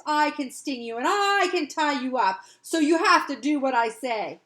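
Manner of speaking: put-on a child loudly laying down the law; bossy threat. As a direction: boss the spider around. Florrie threatening the spider.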